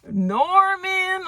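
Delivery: name wailed very high; incredulous exclamation. Acted. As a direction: squeal the name in disbelief. Incredulous at the lover's identity.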